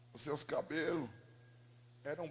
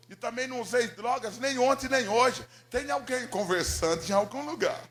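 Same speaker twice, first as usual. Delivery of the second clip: raised, louder self-defence; insistent protest; 5 semitones higher, 9 dB louder than usual. Retold or imitated. Son telling God he is not crazy, insistent. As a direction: protest it emphatically, pitch lifted.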